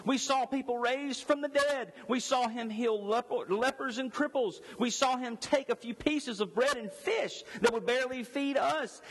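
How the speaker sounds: high, driving preacher voice; full-force preaching. Acted. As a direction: preach it up to the rafters, building. Preaching the apostles' witness.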